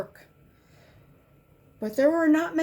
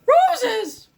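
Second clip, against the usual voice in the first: a high, loud cry of alarm; doctor shouting in alarm; alarmed yell; 12 semitones higher, 8 dB louder than usual. A narrator's reading. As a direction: yell the warning out. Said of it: "yelled the doctor".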